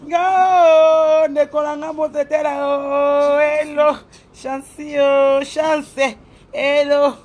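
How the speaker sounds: loud greeting calls. Real, not acted. Calling greetings to a viewer.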